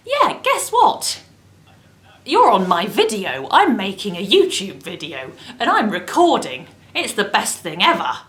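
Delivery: loud, gushing into the phone; bubbly and loud to husband; excited phone chat. Real, not acted.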